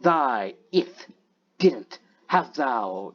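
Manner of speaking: slow mock-archaic phrasing; slowly sample some old-fashioned speech; old-school speech. Shown as a demonstration. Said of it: "old school style speaking".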